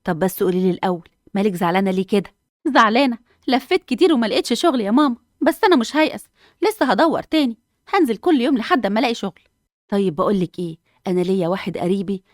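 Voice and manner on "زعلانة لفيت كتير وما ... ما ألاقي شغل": put-on higher-pitched young mother, worn out but determined; tired, then steeling herself not to give up; frustrated job seeker. Hanaa tells mother about job hunt. (صوت أم شابة تعبانة ومصممة)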